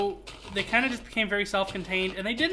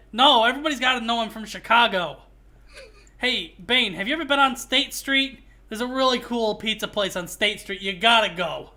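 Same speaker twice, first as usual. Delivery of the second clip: put-on thick Chicago accent. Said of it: "Houston's Chicago accent".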